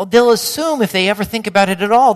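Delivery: ordinary talk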